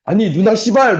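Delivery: hammed-up angry outburst; mock cursing. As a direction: play an aggrieved kid cussing out an older girl. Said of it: "막 욕을 한 거지".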